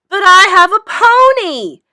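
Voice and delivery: big, high, loud holler; a jealous girl hollering her best boast. A narrator's reading. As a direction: holler it out as the final trump card. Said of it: "she hollered".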